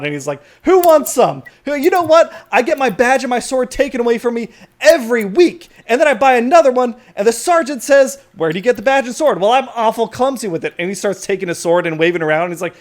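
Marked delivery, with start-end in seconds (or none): 0.6-10.7 high, loud unhinged yelling; Walter Watchman. Acted. Watchman challenges the crowd over his badge, yelling. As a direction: rant belligerently at a whole crowd. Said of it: "he starts yelling at you and at the crowd".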